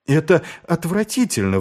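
high-pitched outburst of disgust; indignant exclamation. A narrator's reading. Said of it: "воскликнул Маркоу".

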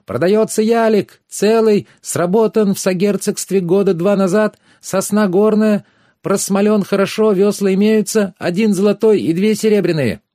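put-on high, loud boy hawking wares; ringing hawker's cry. A narrator's reading. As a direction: shout the sale along the pier like a young crier. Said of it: "звонко выкрикивая". (звонкие выкрики мальчишки-зазывалы)

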